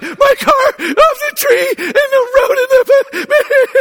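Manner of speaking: high, slow, breaking into laugh-sobs; words breaking apart between tears and laughter; crying and laughing. Retold or imitated. Telling the rescuer about the car, sobbing and laughing. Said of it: "I start crying".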